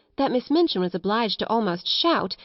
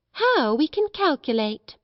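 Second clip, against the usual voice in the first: higher, teasing exclamation; mocking schoolgirl; 7 semitones higher than usual. A narrator's reading. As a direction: needle them with mock amazement. Lavinia mocks another's sums.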